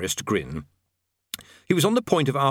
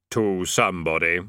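Death voice; 5 semitones lower than usual. A narrator's reading. Death speaks.

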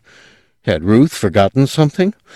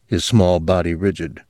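plain reading voice

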